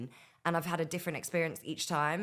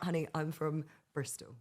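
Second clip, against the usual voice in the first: hint of Bristol accent on honey; 3 dB quieter than usual. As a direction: slip back into broad Bristol. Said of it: "That's how I used to speak".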